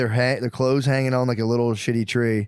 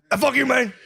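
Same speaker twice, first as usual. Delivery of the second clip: higher-pitched mock "fuck you, man"; a hammed-up, higher retort from a dazed guy; imitating the frozen man; 11 semitones higher than usual. Retelling the dazed man's comeback to the heckler, higher mock retort.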